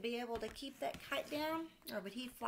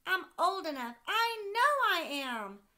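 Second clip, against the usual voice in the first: put-on insistent little boy; 10 semitones higher, 12 dB louder than usual. Sam shouting to dad he is old enough, insistent child. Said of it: "asked Sam".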